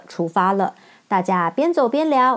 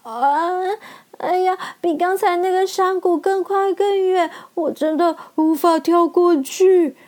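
despairing lion; 8 semitones higher than usual. Acted. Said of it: "lion despairs".

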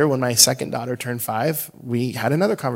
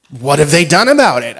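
put-on louder, higher insistent kid question; pressing child; 4 semitones higher, 11 dB louder than usual. Daughter pressing dad for answers, mock insistent. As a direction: push the question like a curious child.

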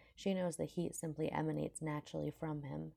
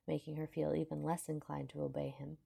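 plain reading voice

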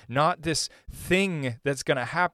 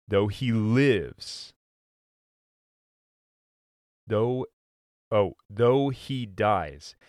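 slow scripture reading; 5 semitones lower, slower than usual. Acted. Pastor reading Jesus' words to the congregation, slow and solemn. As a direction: linger on each phrase of the promise.

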